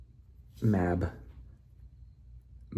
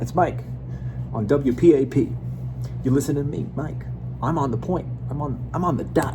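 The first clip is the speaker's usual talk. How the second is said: radio host bragging; 5 semitones higher, 3 dB louder than usual. Acted. Radio host Mike plugs himself.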